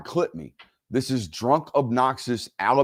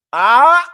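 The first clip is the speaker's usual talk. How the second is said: high, loud triumphant shout; joyful yell; 12 semitones higher, 12 dB louder than usual. Real, not acted. Host celebrates his team with a loud yell. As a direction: let out a victory cry.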